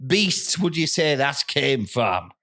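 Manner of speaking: put-on a suspicious farmer in an Irish-sounding accent, breaking into laughter. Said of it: "I've got the accent".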